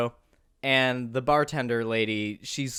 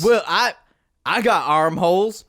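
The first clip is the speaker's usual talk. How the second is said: hammed-up defensive Bubba; 4 semitones higher, 6 dB louder than usual. Bubba voiced, defensive retort.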